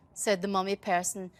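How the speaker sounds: plain reading voice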